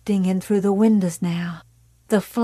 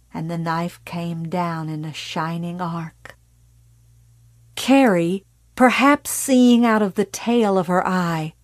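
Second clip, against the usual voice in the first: plain reading voice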